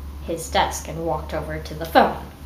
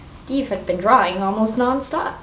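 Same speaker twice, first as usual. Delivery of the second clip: usual speaking voice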